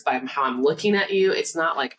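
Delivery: ordinary delivery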